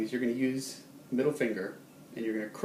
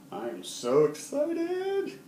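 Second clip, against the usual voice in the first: much higher, drawn out with thrill; so excited; 4 dB louder, much slower than usual. Shown as a demonstration. Teacher demonstrating excitement to students, bubbling high. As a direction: let happy feelings bubble up. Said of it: "how about excited".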